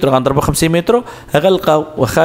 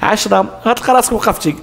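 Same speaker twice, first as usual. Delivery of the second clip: emphatic warning; 6 semitones higher than usual. Real, not acted. Teacher warns students emphatically.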